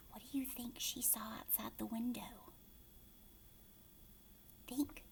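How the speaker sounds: whispered question, soft; hushed suspense. A narrator's reading. Narrator teasing children about what Kristen saw, whispered. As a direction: tease them with a whisper.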